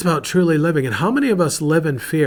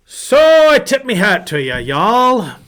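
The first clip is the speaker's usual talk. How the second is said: hearty mock Irish accent, louder and higher; 7 semitones higher, 9 dB louder, slower than usual. Pastor greeting viewers on Saint Patrick's Day, mock Irish accent. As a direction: tip your hat in a playful Irish accent. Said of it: "me best Irish accent".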